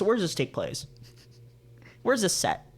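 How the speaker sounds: ordinary talk, nothing marked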